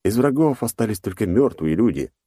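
usual speaking voice

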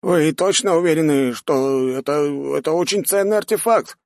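high, hesitant doubting voice; alarmed old mage. Acted. Mage doubts lord's order on precious artifact, high alarmed voice. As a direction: question the order haltingly, pitched high.

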